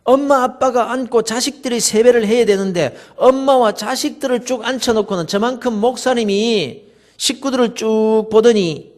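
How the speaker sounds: normal talking voice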